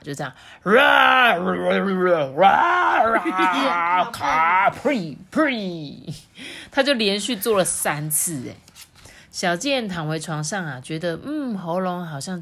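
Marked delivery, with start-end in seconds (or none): 0.6-6.1 gargle hard like the bear; while gargling. Retold or imitated. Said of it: "照着熊医生教他的方法". (学熊医生漱口)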